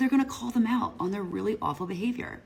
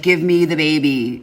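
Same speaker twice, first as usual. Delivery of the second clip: louder, slowed grabby mock voice; mock demand; 10 dB louder than usual. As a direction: imitate a desperate grabby woman. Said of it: "she's one of those, like".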